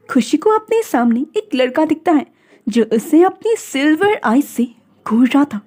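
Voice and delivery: ordinary delivery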